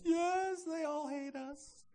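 high, drawn-out mock wail; whining passive victim. Victim insisting nobody understands, high exaggerated whine. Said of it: "that passive victim".

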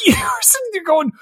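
high, cracking laugh through the words; laughing disbelief. Real, not acted. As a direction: squeal it out in helpless disbelief. Laughing disbelief at absurd scene.

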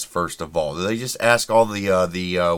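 normal talking voice

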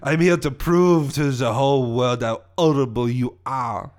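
French villain's proclamation; vengeful Frenchman with dropped H; French accent. Acted. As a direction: declare your scheme in a French accent. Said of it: "my French accent".

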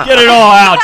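cheering loudly; loud encouragement. Real, not acted. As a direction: shout it with glee. Egging on the guest to swear.

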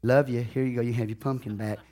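soft, easy, unbothered hand-back; gently defuse it, no drama; a quiet, cool-headed "here you go" to family; calm and collected. Pretend. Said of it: "real calmly and cool and collected".

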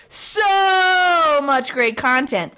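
big drawn-out "So", then the rest follows; extra O's in SO. Real, not acted. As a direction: stretch "So" into one long, slow vowel. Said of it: "added a few more O's there".

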